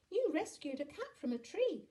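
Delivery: put-on high, loud child voice; high-pitched childish shout; shouting little boy. A narrator's reading. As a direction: call out excitedly like a small boy. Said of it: "shouted Jack".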